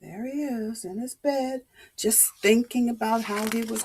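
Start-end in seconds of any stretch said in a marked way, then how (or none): none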